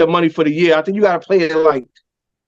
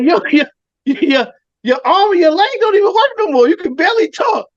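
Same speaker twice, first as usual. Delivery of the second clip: high mock coach; 8 semitones higher than usual. Voicing coach, laughing.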